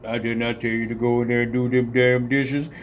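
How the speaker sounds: imagined deep-voiced dad scolding; Mister Motherfucker. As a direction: mimic his low monotone telling off the kids. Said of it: "I can't imagine him talk".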